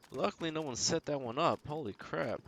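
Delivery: high, excited disbelief; relieved amazement. Real, not acted. Gamer relieved nobody armed the landmine, high and amazed. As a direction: let the shock spill out as relief.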